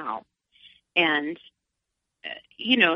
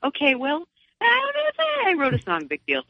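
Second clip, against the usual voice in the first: mock nonchalance; 12 semitones higher than usual. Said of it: "You know what I mean? It's just".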